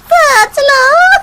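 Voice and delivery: high cackle into slow drawn warning; cackle, then stretch the warning out slowly; cackling witch warning. Acted. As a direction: witch daring the priest to think again.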